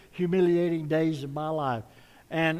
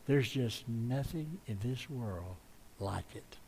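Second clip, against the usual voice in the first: soft and lower, tender sermon close; tender hush; 6 semitones lower, 10 dB quieter than usual. Real, not acted. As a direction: speak lovingly of mothers, softly.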